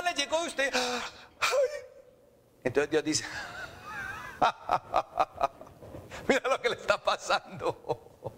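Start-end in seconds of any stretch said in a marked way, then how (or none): 0.0-1.7 higher, wailing cry of alarm; mock panic. Preacher acts out calamity striking the listener, mock panic. 4.4-8.3 exaggerated laugh carrying into the words; mocking laughter. Preacher voices God laughing at the sinner's calamity, mocking laughter. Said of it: "Yo me voy a reír".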